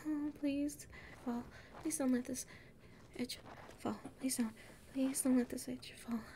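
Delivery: soft, breathy begging; hushed desperate plea; frightened pleading. Acted. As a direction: plead with the crumbling edge. Begging the ledge not collapse.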